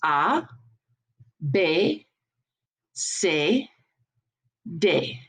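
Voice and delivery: "A, B, C, D" as "ah, bay, say, day"; French letters, one at a time with pauses; French letter names. Shown as a demonstration. Teacher for class to repeat, French "ah, bay, say, day". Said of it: "So repeat after me".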